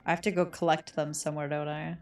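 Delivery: usual speaking voice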